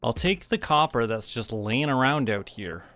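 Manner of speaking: ordinary delivery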